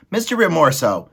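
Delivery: high, mildly tearful appeal; a touch of pleading little mouse; crying mouse. A narrator's reading. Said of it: "and he was crying now".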